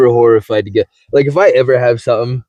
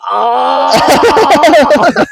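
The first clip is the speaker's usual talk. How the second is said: high, loud yelp of pain; retold pain yelp; 14 semitones higher, 4 dB louder than usual. Telling a friend about the knee shot, sharp high yelp. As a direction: yelp as the needle goes in.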